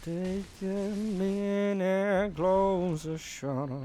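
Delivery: slow, soft spooky voice; drawn-out ghostly murmur; eerie voice. Pretend. Resumes eerie voice.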